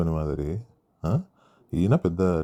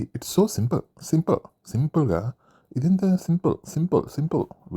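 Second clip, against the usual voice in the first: high-pitched mimicry, far above own voice; mock their habit in a raised, exaggerated pitch; mocking catchphrase imitation; 9 semitones higher, slower than usual. Reviewer ridicules the contestants' habit of oversimplifying, mocking imitation. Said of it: "మనీష్కి ఒక దరిద్రపోటు అలవాటు ఒకటి ఉంది".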